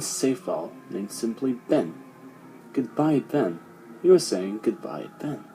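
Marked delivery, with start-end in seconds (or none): none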